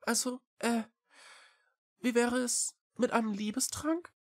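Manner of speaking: high little cat voice, halting; hesitant. A narrator's reading. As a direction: venture it with pauses. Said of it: "Echo zögerte". (zögernde, hohe Katerstimme)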